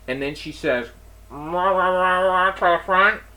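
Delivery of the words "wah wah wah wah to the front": louder garbled wah-wah page, like a store PA; imitating the clerk paging a manager over the PA; mock intercom page. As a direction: squawk it like a muffled loudspeaker call.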